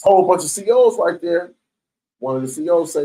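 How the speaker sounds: ordinary talk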